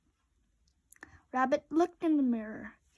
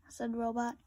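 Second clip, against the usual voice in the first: ordinary delivery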